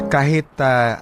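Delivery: ordinary talk, nothing marked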